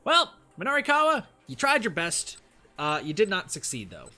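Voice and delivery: high, loud mock sympathy; mock consoling. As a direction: console them with exaggerated pity. Game master consoles failed player.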